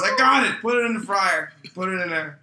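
loud, high, frantic orders easing off; frantic shouting. Retold or imitated. Cook faking a chicken fight, frantic high shouting. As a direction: shout it as if wrestling a live bird.

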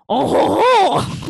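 loud, high French "oh ho ho"; hammed-up French chortle; mock French laugh. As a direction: guffaw like a caricature Frenchman. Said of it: "teach you how to speak French".

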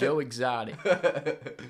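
slow, drawn-out mock-reverent name; Joe Exotic. Co-host introduces his hero, slow put-on voice. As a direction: draw the name out slowly in character. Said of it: "or, as we should say".

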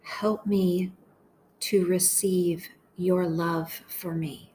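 slow, measured meditation voice with long pauses; unhurried, deliberate phrases for listeners to echo; guided prayer meditation. Acted. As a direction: pray each short phrase slowly, leaving space to repeat it.

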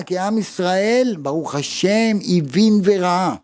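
usual speaking voice

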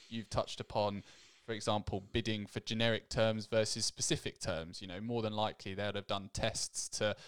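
normal talking voice

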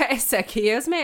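high, drawn-out mock indignation; affronted. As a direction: stretch it out, playfully scandalised. Repeats offended reply for laughs.

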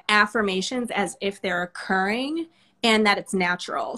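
ordinary talk

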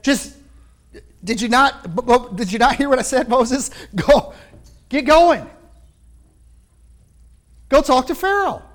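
God insisting. Retold or imitated. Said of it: "He said".

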